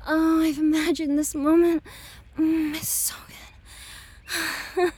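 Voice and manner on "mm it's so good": breathy whispered sigh. Acted. Lover whispering to Daddy after sex. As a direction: whisper it, spent and satisfied.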